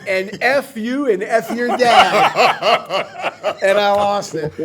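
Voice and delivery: loud punchline through real laughter; laughing. Real, not acted. Retelling a friend's retort at a party, laughing loudly. Cracks up delivering retold punchline.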